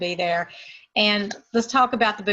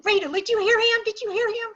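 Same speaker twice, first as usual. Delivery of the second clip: high, astonished calling out; amazed exclamation; 13 semitones higher, 4 dB louder than usual. Retold or imitated. Ann nudging Rita in amazement during the doctor's talk. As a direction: gasp it to your friend in disbelief.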